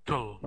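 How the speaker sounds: tul said with no vowel, just t and l. Shown as a demonstration. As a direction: clip tul so there is no vowel in it. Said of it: "we don't have any vowel sound. It's just".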